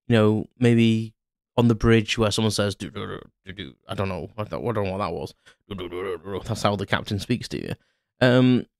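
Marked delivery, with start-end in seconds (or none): 2.8-3.7 mock computer beeps. Fan imitating bridge computer sounds, soft beeps. 5.7-6.4 soft, low-level beeping tones; mock computer beeps. Fan imitating bridge computer sounds, soft beeps.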